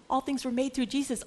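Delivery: ordinary delivery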